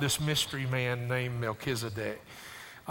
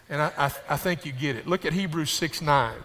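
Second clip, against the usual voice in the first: ordinary talk, nothing marked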